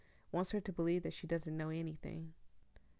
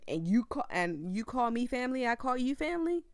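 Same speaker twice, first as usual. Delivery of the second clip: louder, rising angry rant; protective rant; 6 dB louder than usual. Real, not acted. Streamer telling viewers nobody hurts family, rising angry. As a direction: build to a furious no, no, no.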